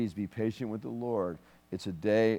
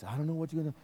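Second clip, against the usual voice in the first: higher-voiced imitation of fretting parents; mock worried parents; 7 semitones higher, much faster than usual. Minister imitating her parents arguing at night, mock worry. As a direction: mimic the parents' worried bedroom talk, lightly. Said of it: "they talk like this".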